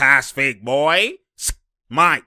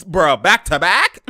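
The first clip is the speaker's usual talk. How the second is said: normal talking voice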